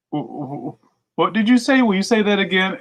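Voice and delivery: stammering scammer. Retold or imitated. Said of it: "they're like".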